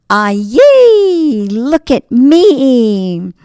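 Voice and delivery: high squeal of joy, then a slow drawn-out look at me; a tiny pastry boy thrilled to be alive; gleeful cry. Acted. Beignet boy shows himself off, high excited glee.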